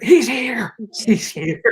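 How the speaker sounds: high, loud giddy squeal; starstruck excitement. Acted. Giggling actors spot the star arriving, squealed excitedly. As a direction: squeal it like an overwhelmed fan.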